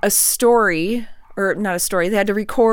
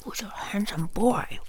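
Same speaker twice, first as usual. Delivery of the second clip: soft unvoiced dog praise; whispering to a dog; 7 dB quieter than usual. Real, not acted.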